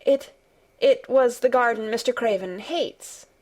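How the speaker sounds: hesitant, stumbling start; nervous. A narrator's reading. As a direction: stammer the first word as if nervous. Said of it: "said Mary nervously".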